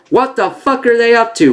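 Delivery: high-pitched mock disbelief; imitated baffled Marines. Recapper voicing Marines watching the pirates, mock high-pitched bafflement. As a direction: exclaim it high, as a bewildered soldier. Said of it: "still conscious are like".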